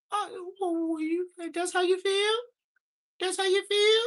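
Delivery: put-on high little kid voice, daring and loud; squeaky kid challenge. Kid confronting Mexican kid.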